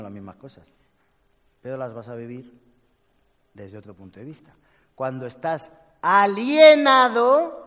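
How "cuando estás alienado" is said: emphatic. Acted. Stressing being alienated.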